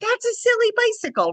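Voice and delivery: high, sing-song puppy talk; jollying the puppy. Retold or imitated. Trainer soothing a barking puppy, high jolly baby talk. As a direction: cheerfully coo to the puppy that a bike is nothing scary. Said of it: "So I'm jollying the puppy".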